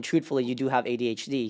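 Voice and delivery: usual speaking voice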